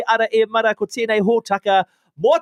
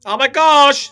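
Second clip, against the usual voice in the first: sassy drag queen; 4 semitones higher, 7 dB louder, slower than usual. Pretend. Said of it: "I sound like a drag queen".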